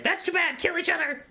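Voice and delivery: very high, mock-evil screech; evil villainess. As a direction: squeal it like a cartoon villainess. Same evil priestess voice returns.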